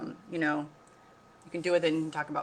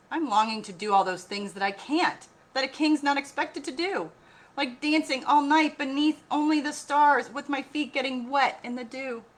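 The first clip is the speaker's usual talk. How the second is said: high, louder longing voice; wistful king; 8 semitones higher, 6 dB louder than usual. A narrator's reading. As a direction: dream aloud of dancing under stars. King longs to be free.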